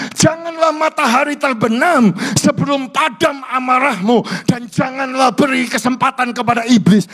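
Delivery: loud, high fiery sermon voice; preaching at full force. Acted. Pastor urging husbands and wives, fiery preaching. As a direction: thunder the warning at the congregation.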